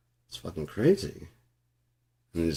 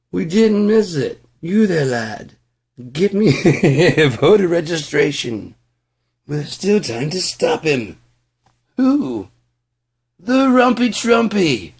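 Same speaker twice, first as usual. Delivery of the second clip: high, loud hammed-up British accent; 10 semitones higher, 9 dB louder than usual. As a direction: play an old British gent barking orders. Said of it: "the British accent".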